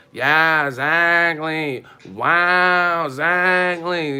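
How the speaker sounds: slow, loud, stretched-out mock agreement; drawn-out loud neighbor. Arguing neighbor egging on the fight, slow loud mock yell. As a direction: stretch each word like a rowdy neighbor hyping her side.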